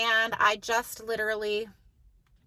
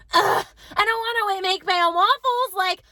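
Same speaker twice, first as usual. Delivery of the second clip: higher, louder mock whine with a groan; whiny kid imitation; 6 semitones higher, 4 dB louder than usual. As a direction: complain like a put-upon child. Voices son whining about waffles.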